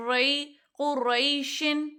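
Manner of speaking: رئی then قریشن said slowly and clearly; slow demonstration. Shown as a demonstration. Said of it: "لکھا ہے، قریشن". (آہستہ ہجے کر کے قریشن)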